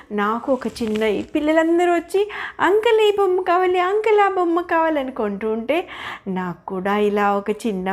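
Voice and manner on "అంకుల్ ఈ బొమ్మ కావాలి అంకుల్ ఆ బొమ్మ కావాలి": put-on high, eager kid voices; children begging. Kids pleading with the toymaker, high and eager. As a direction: beg like little children for toys.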